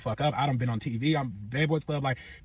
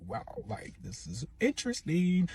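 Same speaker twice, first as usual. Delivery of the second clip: understated amazement; 4 semitones higher, 5 dB quieter, slower than usual. Retold or imitated. Bystander reacting to the argument.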